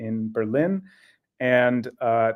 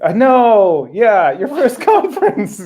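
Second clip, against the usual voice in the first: high, laughing and louder; 9 semitones higher, 9 dB louder, slower than usual. Real, not acted. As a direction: laugh through the words. Laughing about the goose.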